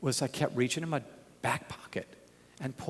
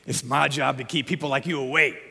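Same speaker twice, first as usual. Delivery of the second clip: bouncer voice; 5 dB louder than usual. Retold or imitated. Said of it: "And then he goes".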